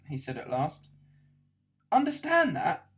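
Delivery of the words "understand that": high, loud incredulous shout; shouted in outrage. A narrator's reading. Arthur to Ford about destroyed Earth, outraged shout. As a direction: yell it back at him in disbelief. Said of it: "shouted Arthur".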